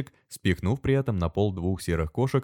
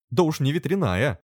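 higher, derisive quip; teasing, higher-pitched jibe; mocking; 8 semitones higher, 3 dB louder than usual. A narrator's reading. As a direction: toss it off mockingly. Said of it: "насмешливо проговорил он".

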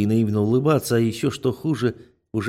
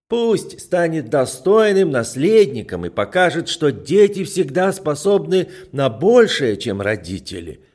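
put-on loud, slow, drunk uncle toast; tipsy toast; 6 semitones higher, 5 dB louder than usual. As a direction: raise the glass, tipsy and loud.

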